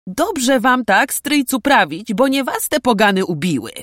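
high, loud scornful retort; indignant snort. A narrator's reading. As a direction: throw it back with scorn. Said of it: "prychnął Bartosz".

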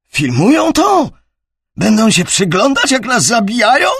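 high, loud disbelieving cry; panicked. A narrator's reading. Soldier panics under fire.

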